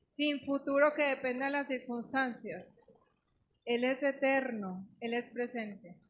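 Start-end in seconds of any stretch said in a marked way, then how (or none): none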